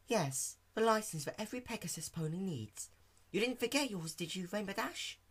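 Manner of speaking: high, soft, gentle question; quietly checking on a friend; Fluttershy softly asking Dash about her license. Acted.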